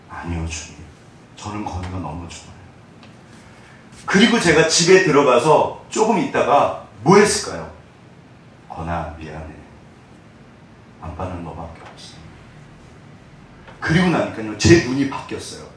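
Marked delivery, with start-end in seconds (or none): 0.1-2.5 much softer, humble reply; hushed answer. Retold or imitated. Pastor answers God's question in prayer, much softer. 8.7-12.1 much softer, lower voice to his son; tender apology. Retold or imitated. Father apologizes to his little son, much softer and tender.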